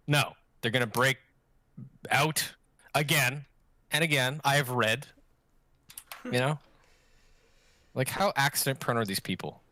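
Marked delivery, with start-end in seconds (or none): none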